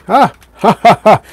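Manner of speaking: high, loud villain's cackle, in separate bursts; mock evil laugh. Streamer playing schemer to a viewer, mock evil laugh. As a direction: burst into a hammed-up "ha ha ha" of triumph.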